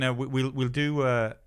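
ordinary delivery

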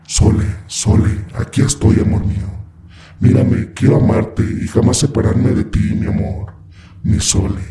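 ghostly whispered demon voice; voice from beyond the grave. A narrator's reading. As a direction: breathe it like a spirit from the tomb. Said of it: "con una voz de ultratumba pronunciaba su nombre".

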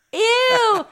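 shrieked, high, loud ew; disgusted ew. Real, not acted. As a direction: squeal it in total disgust. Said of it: "I can make an ew sound".

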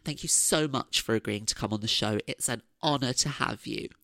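ordinary delivery

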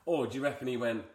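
ordinary talk, nothing marked

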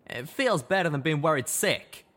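high, loud frustrated explanation; anger covering worry. Acted.

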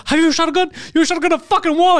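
high mock fury, ending on a rise; retold angry rant. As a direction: squeal it like you're losing your mind. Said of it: "I go".

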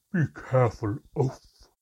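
sharp loud snap; snapped. A narrator's reading. The mermaid snapping at the clumsy narrator, loud and sharp. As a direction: bite at him for the clumsy splash. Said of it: "snapped the mermaid".

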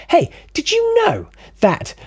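high-pitched mock eagerness; lightly hammed-up, high opener; eager fact-sharing. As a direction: pounce on someone with your fact. Eagerly sharing a really good fact.

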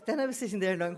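ordinary delivery, nothing marked

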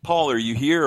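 calling out. Pretend. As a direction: pretend to check whether someone is in the room. Suggests calling out to check for Paul.

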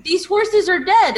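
loud call out; yelling across. Acted. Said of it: "I just wanna yell over there".

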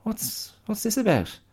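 high, worried pig voice; a pig spotting trouble coming; high, uneasy pig chatter; alarmed pig. Retold or imitated. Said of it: "the pigs are like".

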